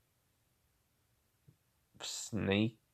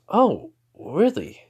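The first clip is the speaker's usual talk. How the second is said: high, slow, drawn-out mock surprise; lightly voiced amused surprise; surprised Kurama; 9 semitones higher, 5 dB louder, slower than usual.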